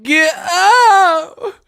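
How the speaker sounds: high squealed yell. Retold or imitated. Streamer copying the played clip for laughs, squealing imitation. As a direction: echo the clip in a shrieking falsetto. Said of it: "I ain't never yelled for no man".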